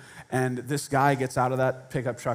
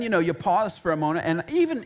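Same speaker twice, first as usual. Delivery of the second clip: ordinary talk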